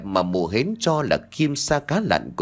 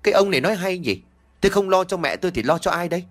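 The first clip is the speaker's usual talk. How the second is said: higher, fast indignant retort; talking back; 6 semitones higher, much faster than usual. Acted. Hến argues back at the villager, higher and fast. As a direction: throw it back at him, offended and quick. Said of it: "rồi cãi".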